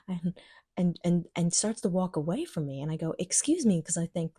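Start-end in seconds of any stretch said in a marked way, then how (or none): 3.2-3.8 "Excuse me" called out higher and louder. Retold or imitated. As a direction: raise your voice so she knows it's her. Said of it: "maybe she thinks I'm not talking to her".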